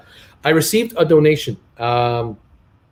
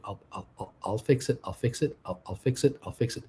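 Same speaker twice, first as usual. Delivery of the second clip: muttering; 5 semitones lower, 11 dB quieter than usual. Real, not acted. Mutters reassurance to self.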